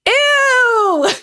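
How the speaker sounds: high, loud squeal of disgust; grossed-out shriek. Real, not acted. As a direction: recoil with a shrieked ew. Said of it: "I said".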